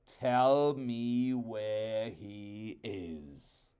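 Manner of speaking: very slow, stern royal command. Acted. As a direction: weigh each word like a king.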